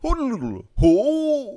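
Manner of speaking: high rolling gibberish into a drawn-out hoot; Pianta call. Acted. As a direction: whoop like a cartoon creature on request. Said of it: "How do they go?".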